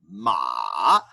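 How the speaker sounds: ma falling low then rising; dipping tone. Shown as a demonstration. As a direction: dip ma down and bring it up. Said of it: "going down and up".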